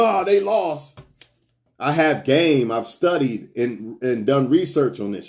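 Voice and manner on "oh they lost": higher, put-on whiny fan voice; mock a fan crushed by a loss; hammed-up sad sports fan; mock upset fan. Mocking an emotional sports fan.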